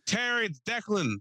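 high, slow call through a door. Retold or imitated. Neighbor identifying himself at the door, high drawn-out call. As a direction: holler who it is.

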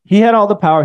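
bitter cry against a powerful God; angry accusation. Pretend. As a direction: hurl the blame upward.

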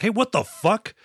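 taken aback, half-playing it; retold indignant protest. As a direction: act out an affronted samurai. Acted samurai protests attempted blow.

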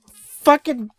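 high, loud cry of protest; exasperated yell. Real, not acted. Host derailed mid-story by co-hosts, yelling in exasperation.

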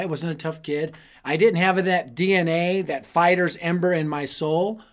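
ordinary talk

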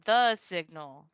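THE stretched out, slow and pointed; slow, emphatic THE before "signal"; stress on THE. Acted. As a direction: say THE like it has a capital T. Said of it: "like, capital T".

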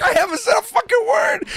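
very high, shouty mock protest; retold indignant squawk; exasperated denial. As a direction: protest innocence at the top of your pitch. Retold protest while everyone scolds.

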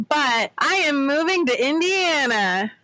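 sing-song fake enthusiasm; mock joy. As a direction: announce it with put-on glee. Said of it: "the genuine joy in that voice".

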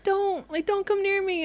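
high-pitched, fearful mock plea; pleading Noah. Fan acts out Noah warning his friend off, high plea. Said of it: "Noah being like".

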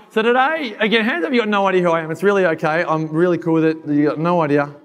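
normal talking voice

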